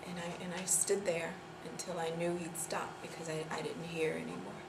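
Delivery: soft, low, halting admission; subdued, guilty near-murmur; hushed confession. Acted. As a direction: confess it quietly, with long pauses.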